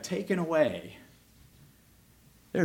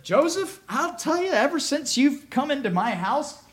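higher, brighter voice of a delighted master; pleased master; 12 semitones higher than usual. Acted. Potiphar praising Joseph, lightly voiced as a pleased master. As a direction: sound thrilled with your servant. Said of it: "And Potiphar said".

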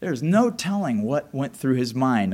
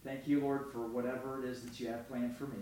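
much softer, hushed prayer; quiet prayer; 2 semitones lower, 14 dB quieter than usual. Acted. Joseph praying softly in prison, hushed. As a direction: murmur it like a private prayer in a cell. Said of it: "and he says".

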